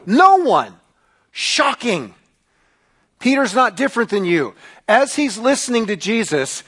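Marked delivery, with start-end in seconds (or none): 0.1-2.1 drawn-out sarcastic surprise; mock shocked. Preacher reacts to no raised hands, mock shocked. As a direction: feign amazement, stretching the words.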